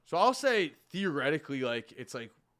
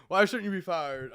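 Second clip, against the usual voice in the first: ordinary talk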